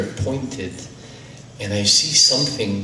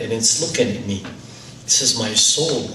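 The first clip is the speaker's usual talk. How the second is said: usual speaking voice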